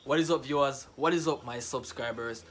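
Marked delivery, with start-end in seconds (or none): none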